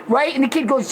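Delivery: normal talking voice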